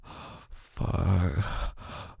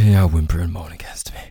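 whisper; 4 dB louder than usual. Acted. Intimate roleplay, whispered line.

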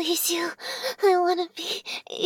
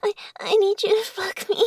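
ordinary talk

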